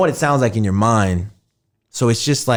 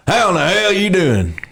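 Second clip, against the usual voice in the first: Texan accent; 7 semitones higher than usual. Shown as a demonstration. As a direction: holler it like a Texan.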